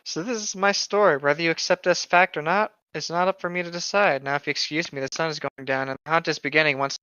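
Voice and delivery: hammed-up storyteller read; dramatic story reading. Reading a fan story aloud to a friend, hammed-up narration. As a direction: read the creepypasta like a mock-serious tale.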